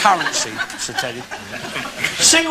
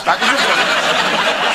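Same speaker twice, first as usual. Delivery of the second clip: drawn out, slower; slow repeat; slower than usual. Acted. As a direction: spell it out slowly for effect.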